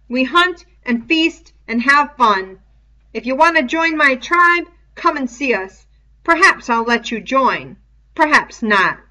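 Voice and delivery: loud challenge to the group; shouting. Acted. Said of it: "and began to shout".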